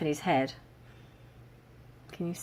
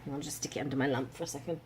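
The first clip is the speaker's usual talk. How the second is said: usual speaking voice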